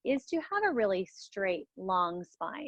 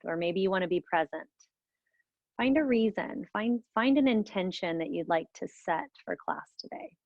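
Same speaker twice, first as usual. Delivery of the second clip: guided meditation; 2 semitones lower than usual. Acted. Leads a guided breathing meditation.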